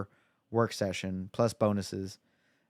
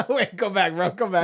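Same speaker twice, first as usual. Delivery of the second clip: laughing while calling him out; 8 semitones higher, 4 dB louder than usual. Real, not acted. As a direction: laugh out "go back, bro". Laughing, catching friend's reversal.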